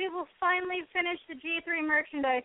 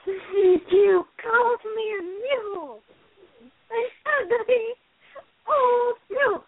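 drama queen Rarity voice; 5 semitones higher than usual. Acted. Rarity quote from Diamond Dogs.

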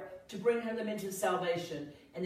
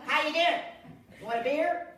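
high, loud waitress call; intruding waitress; 8 semitones higher, 9 dB louder than usual. Retold or imitated. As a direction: holler out a drink offer, imitating the waitress. Waitress barges in on tearful moment.

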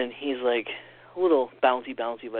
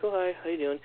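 higher-pitched mimicked hello; imitated greeting; 6 semitones higher than usual. Angela greeting the kids, imitated high hello. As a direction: lightly put on a friendly greeting.

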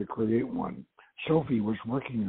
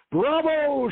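high, loud cheer; shouted bravo; 5 dB louder than usual. A narrator's reading. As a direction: call out like someone in an applauding crowd. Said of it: "somebody shouted".

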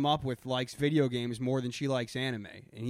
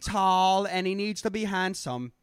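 bad Australian accent; 4 semitones higher than usual. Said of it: "I can't even do their dumb fucking accent".

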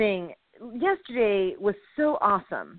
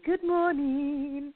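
much slower, stretched good morning; slow drawn-out greeting; 7 semitones higher, much slower than usual. Real, not acted. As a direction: drag the words out sleepily. Drawn-out tired repeat.

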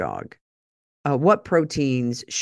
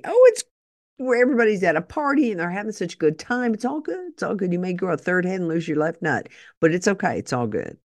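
breezy, fast, sing-song ad voice, pitched up; mock pharma ad; 4 semitones higher, faster than usual. Host mocking a drug ad to her viewers, breezy sing-song. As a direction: sell it like a carefree drug commercial.

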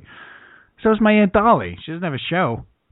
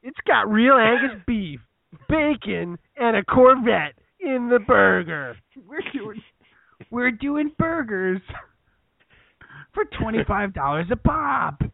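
hammed-up burger huckster; Guy Fieri; 4 semitones higher than usual. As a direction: drag out each item like a hyped TV host. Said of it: "Guy Fieri's thirty dollar Burgers".

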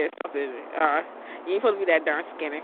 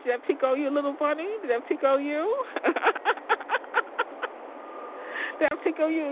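higher, sing-song voice for the bunny; teasing the bunny in a higher, cooing pet voice; pet talk; 9 semitones higher than usual. Real, not acted. Teasing talking to her bunny.

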